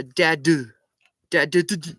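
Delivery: mocking stammer. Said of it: "There was a".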